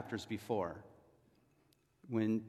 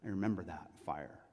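low, very soft remembering; sinking realization; 6 semitones lower, 9 dB quieter than usual. Retold or imitated. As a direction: say it as if the stomach just dropped. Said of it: "just the pit in his stomach comes".

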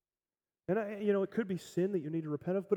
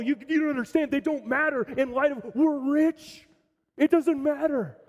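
higher, louder, elated explanation; overjoyed; 6 semitones higher, 6 dB louder than usual. Acted. Dad telling his family he won the lotto, overjoyed. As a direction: burst out the lotto news. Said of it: "he's overjoyed".

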